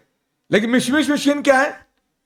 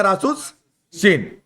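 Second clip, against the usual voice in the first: slow spelled-out term; 3 semitones lower, much slower than usual. Shown as a demonstration. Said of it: "کیا آئے گا؟".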